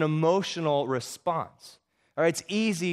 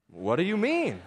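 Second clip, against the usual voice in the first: higher, surprised question rising in disbelief; puzzled; 6 semitones higher than usual. A narrator's reading. Father asking his son about the fight, puzzled. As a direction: voice a baffled dad.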